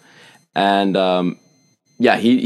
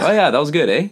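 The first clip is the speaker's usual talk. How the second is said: higher, lively Canadian tag question; Canadian "eh" habit; 7 semitones higher, 4 dB louder, faster than usual. Retold or imitated. Chatting with people in Boston, upbeat Canadian "eh". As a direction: chirp it with a Canadian "eh". Demonstrates his own Canadian eh.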